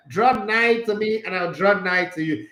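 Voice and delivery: mock-solemn archaic verse; King James reading. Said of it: "King James and all those Amomo English".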